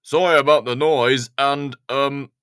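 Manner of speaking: a clumsy fellow owning up; Ted, embarrassed. Acted. Ted explains the crash.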